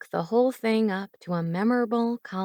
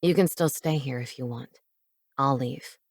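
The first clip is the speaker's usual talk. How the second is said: put-on quieter, lower offer from a young man; low soft man's voice; 6 semitones lower than usual. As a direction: back off gently in a low voice. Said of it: "he offered".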